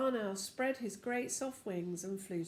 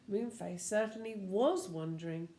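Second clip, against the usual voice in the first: ordinary delivery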